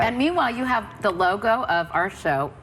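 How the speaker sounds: talking with mouth full. Real, not acted. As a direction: talk through a mouthful of cupcake. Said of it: "Sorry, I'm trying to talk with my mouth full".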